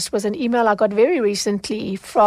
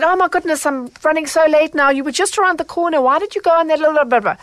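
higher, quick irritated complaint; retold annoyance at a late Uber driver, higher and fast; annoyed tone; 7 semitones higher, faster than usual. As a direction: snap at the driver, annoyed and rushed. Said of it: "what I will admit was an annoyed tone".

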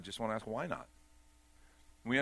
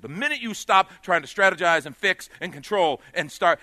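louder, higher preacher's push; preaching at full force; 6 semitones higher, 11 dB louder than usual. Acted. Preacher urging listeners to stop controlling, preaching at full force. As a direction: lift the room with it.

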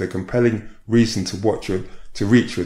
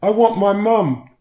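put-on childlike whimper; 11 semitones higher, 5 dB louder than usual. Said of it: "she began to whimper, like a young child".

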